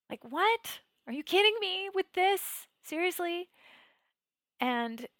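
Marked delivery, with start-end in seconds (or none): 0.1-3.5 high, loud, incredulous protest; mad at God. Retold or imitated. Meditator challenging God over her bad luck, indignant. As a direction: raise the pitch in outraged disbelief. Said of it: "how mad I was at God".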